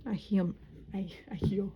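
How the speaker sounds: put-on accidental babyish little voice. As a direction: slip into a tiny baby voice. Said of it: "came out like a baby voice".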